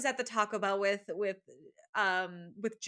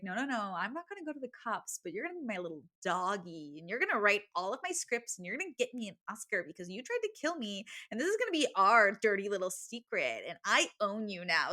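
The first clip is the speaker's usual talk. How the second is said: quietly menacing threat; 4 semitones lower, 6 dB quieter, faster than usual. Retold or imitated. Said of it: "Forty is pissed".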